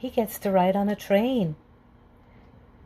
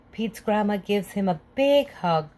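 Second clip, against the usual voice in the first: ordinary delivery, nothing marked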